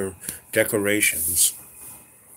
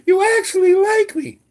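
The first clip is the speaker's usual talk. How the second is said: much higher, louder gushing award-speech voice; overjoyed actress thanking the crowd, much higher and louder; Sally Field; 8 dB louder than usual. Retold or imitated. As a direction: squeal it like a tearful Oscar winner. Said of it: "that moment at the Oscars when Sally Fields got her little thing".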